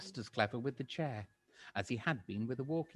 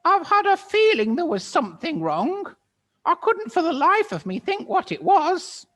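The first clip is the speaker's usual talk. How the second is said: put-on much higher, loud, fussy old-woman voice; shrill old Missus Twit; 12 dB louder than usual. Missus Twit startled, answering her husband in a high old-woman voice. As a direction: play a flustered old hag. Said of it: "Missus Twit said".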